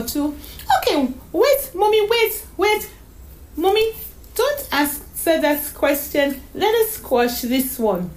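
put-on high child's voice, hurriedly backtracking; eager child taking back a question; flustered little girl. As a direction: plead with Mommy like a small girl. Voices young Donna May in story.

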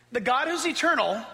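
ordinary delivery

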